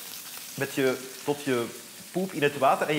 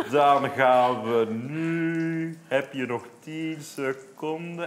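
very slow, drawn-out speech; slow motion; much slower than usual. Pretend. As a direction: stretch every word out slowly. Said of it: "ik zal heel traag praten".

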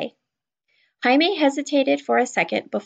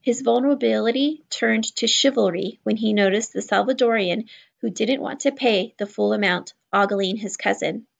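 ordinary delivery

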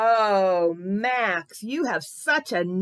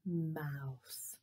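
hushed secret whisper; 8 semitones lower, 14 dB quieter than usual. Acted. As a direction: whisper the word. Whispered word to kids.

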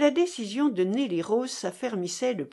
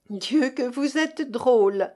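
amused exclaim; 4 semitones higher, 5 dB louder than usual. A narrator's reading. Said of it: "s'écria-t-elle".

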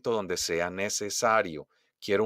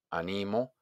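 softer "animo", stressed on NI; stress on middle syllable; 6 dB quieter than usual. Shown as a demonstration. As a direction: say "animo" softly with weight on NI. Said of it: "la número dos es simplemente yo animo". ("animo" más suave, acento en NI)

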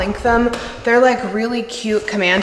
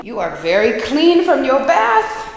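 doggy talk; 6 semitones higher, 7 dB louder than usual. Real, not acted. Owner praising her clean dog in baby talk. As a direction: coo at the freshly bathed puppy. Talking to dog after bath.

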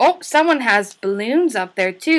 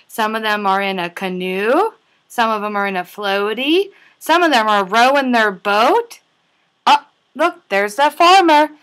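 ordinary delivery

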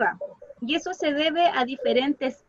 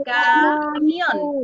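camión drawn out loudly in two parts; teacher's demo of camión with MIÓN stressed; 7 dB louder than usual. Shown as a demonstration. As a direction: split camión slowly, landing firmly on MIÓN. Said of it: "¿Dónde marqué más la voz?".

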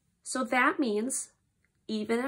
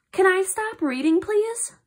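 "Can I stop reading, please" pitched high and rising; question tune; 6 semitones higher than usual. Shown as a demonstration. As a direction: lift "please" high, as a plea. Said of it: "Here, I'll read it again".

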